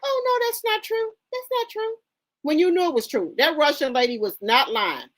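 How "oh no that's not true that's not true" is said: high, mocking imitation of a flustered denial. Mocking him for getting mad when the Russian woman spoke up, high imitation of his denial. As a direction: parrot his panicky denial back at him. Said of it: "you was like".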